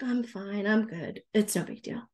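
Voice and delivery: soft, low, defeated I'm fine; quiet, beaten-down reassurance; dejected fine. Shown as a demonstration. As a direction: let the words say fine but the voice say not. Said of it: "but their spirit is like".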